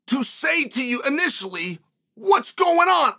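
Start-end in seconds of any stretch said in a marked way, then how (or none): 2.1-3.1 high, slow call raised over the engine; loud over the truck. Retold or imitated. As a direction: shout it over a diesel truck. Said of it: "I must be loud".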